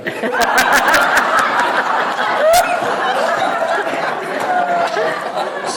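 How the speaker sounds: the audience roars with laughter; big laugh rolls through the room; crowd laughter. Real, not acted.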